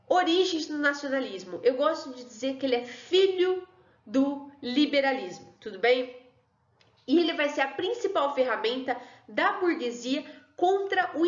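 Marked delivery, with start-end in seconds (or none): none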